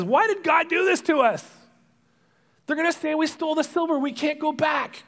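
high, loud panicked protest, acted out; alarmed brother. Brother finding silver in his bag, alarmed. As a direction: voice jumps up in mock panic. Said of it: "One of them says".

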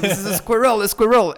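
put-on "squirrel" repeated high and loud as "skwer-el" with a French accent; frustrated, high and loud French repeat of "squirrel"; exasperated French squirrel. [name] repeating the word to teasing friends, in an exasperated loud French accent. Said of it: "I'm like".

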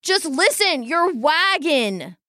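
high, pressing plea; boy pleading with the soothsayer; urgent plea. Acted. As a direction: make her listen.

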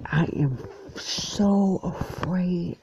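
high, drawn-out "so afraid"; mock-fearful emphasis, slow and high; retold fear. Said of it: "I was so nervous".

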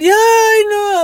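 very high, loud drawn-out wail; hysterical crying. Retold or imitated. Devastated woman announces a death to her driver, mock wailing. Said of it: "She's crying".

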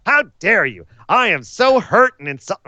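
lightly hammed-up insulted heel; mock offended Seth. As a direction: overplay the hurt feelings.

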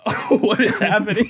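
confused brother. Retold or imitated. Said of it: "my brother's like".

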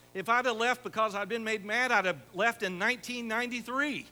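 preacher rallying the congregation, loud; full-force preaching. Real, not acted. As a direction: preach it to the rafters.

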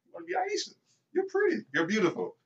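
high, soft mock flattery; sweet-talking demo. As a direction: coo a compliment in a put-on high voice. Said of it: "That's how I say it".

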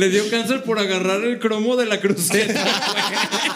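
joking through laughter; amused, laughing punchline. Real, not acted. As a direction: barely hold back the laugh while talking. Jokes through laughter about the ending.

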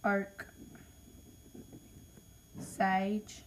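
ordinary talk, nothing marked

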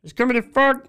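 high frog voice attempt; Kermit voice. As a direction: reach up for Kermit. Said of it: "the Kermit voice".

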